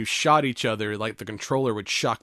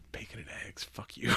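breathy whisper, much softer; whispered aside; 12 dB quieter than usual. Real, not acted. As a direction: toss off the pun under your breath. Muttered aside at a pun.

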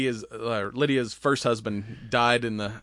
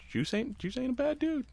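imitating Juice; 6 dB quieter than usual. Voicing Juice, high and soft.

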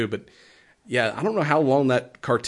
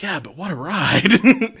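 drawn-out, louder mock enthusiasm; retold gleeful quip; 5 semitones higher, 4 dB louder, slower than usual. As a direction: stretch it out like a fond boast. Retelling a film character's upbeat reply.

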